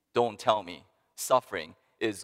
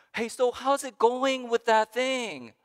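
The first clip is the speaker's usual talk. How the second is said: high, slow, breezy check-in voice; casual friendly asker; 12 semitones higher, slower than usual. Pretend. Friend casually asking a hurting person, high breezy voice. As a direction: ask lightly like a well-meaning friend.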